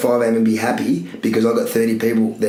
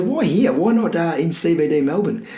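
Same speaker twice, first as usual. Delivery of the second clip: higher-pitched, lightly put-on Italian accent; a half-hearted Italian visitor asking why not the city; mock Italian accent; 7 semitones higher than usual. Italian visitor asking why not open in Melbourne CBD, light mock Italian accent. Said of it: "he's an Italian fella".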